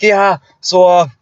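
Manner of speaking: high, hammed-up Aussie impression; Australian accent. Mimicking coworker going on about university girls, thick Australian accent. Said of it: "in a thick Australian accent".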